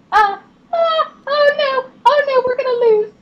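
high-pitched, fretting gamer squeal; playful panic. Real, not acted. Gamer sees the team about to lose, high-pitched panic. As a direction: squeal in alarm as the game slips away.

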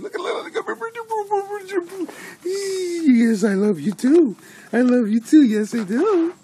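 very high, loud pet-cooing squeal. Real, not acted. Owner telling the dog I love you too, baby talk.

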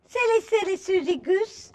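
high playful teasing; singsong teasing of Susie. Acted. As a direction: tease the silly goose. Teasing the goose in story.

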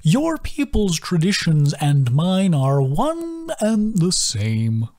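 very slow, wildly rising and falling; slow arpeggiating turtle sigh; sighing sing-song. A narrator's reading. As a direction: sigh it in a lilting sweep. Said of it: "his voice arpeggiating wildly".

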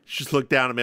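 ordinary delivery

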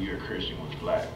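usual speaking voice

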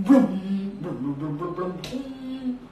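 slowing run of burbles; bubbling sound. Acted. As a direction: let the bubbles trail into a hum. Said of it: "puts it inside".

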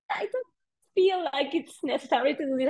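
high, hesitant protest; reluctant. Retold or imitated. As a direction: object nervously to the guide. Reluctant reply to guide.

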